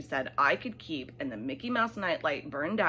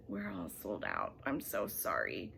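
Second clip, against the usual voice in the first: much lower, softer apologetic shoe man; shoe salesman voice; 10 semitones lower, 9 dB quieter than usual. Acted. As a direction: drop low and quiet as the sorry salesman.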